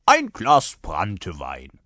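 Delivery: loud, slow soldier's order; brusque rider. A narrator's reading. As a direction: demand a drink, drawing it out. (barscher Reiter, laut und gedehnt)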